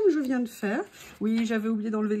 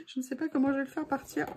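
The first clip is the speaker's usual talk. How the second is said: quick, soft, laughing words; laughing through it; 4 dB quieter, much faster than usual. Real, not acted. Crafter laughing at the glue she can't remove, quick and soft. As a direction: laugh while you say it.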